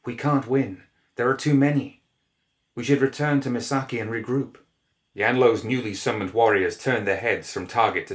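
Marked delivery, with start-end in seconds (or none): 0.0-4.6 high, anxious pleading voice; frightened plea. A narrator's reading. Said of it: "whispered Chiaki with a tremble".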